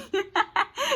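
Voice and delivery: high giggle; high laugh. Real, not acted. Laughs at own joke.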